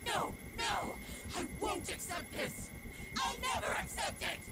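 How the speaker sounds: anguished, high-pitched outburst; desperate refusal. Acted. Woman refusing to accept it, desperate cry. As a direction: refuse it with everything you have.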